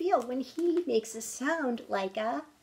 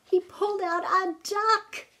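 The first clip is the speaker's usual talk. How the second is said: higher, louder amazed announcement; surprised reveal; 9 semitones higher, 6 dB louder than usual. Acted. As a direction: gasp it out like a big surprise.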